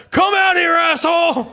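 high, loud mock police yell; imitated cop's full shout; cop shouting outside. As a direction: holler it at someone holed up inside. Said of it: "you just hear him from outside".

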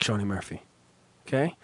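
ordinary delivery

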